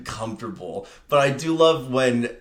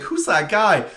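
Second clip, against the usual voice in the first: swooning onlooker; 7 semitones higher, 4 dB louder than usual. Retold or imitated. Someone swooning in the movie.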